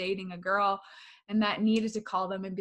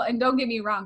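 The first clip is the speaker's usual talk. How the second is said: ordinary delivery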